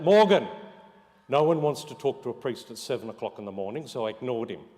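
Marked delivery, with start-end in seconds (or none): 0.0-0.5 loud call across the road, name flung high; yelled name. Retold or imitated. Priest calling across the road, a loud high shout. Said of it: "And he yells out".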